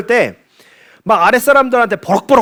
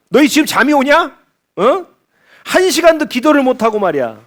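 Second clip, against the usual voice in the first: higher-pitched mock reproach; acted scolding; 6 semitones higher than usual. Jesus finds the disciples sleeping, acted sudden scolding. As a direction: scold them in exasperated disbelief, acting it out. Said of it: "갑자기 이렇게 타박을 하시는".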